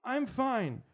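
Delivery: put-on high, slow child voice; a boy who sounds weak and dreamy; kid voice. Kid's high voice in scene.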